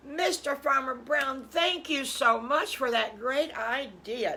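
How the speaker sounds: high, loud gushing thanks; excited little pigs bursting with thanks; excited boys. Acted. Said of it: "the boys were so excited".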